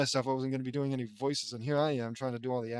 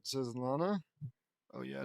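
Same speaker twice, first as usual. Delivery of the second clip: plain reading voice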